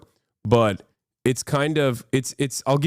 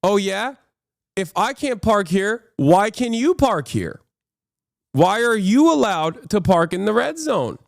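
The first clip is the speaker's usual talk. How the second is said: higher, mocking challenge; higher smug driver voice; asshole character; 7 semitones higher than usual. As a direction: throw the cop's rule back at him. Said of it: "the asshole character says".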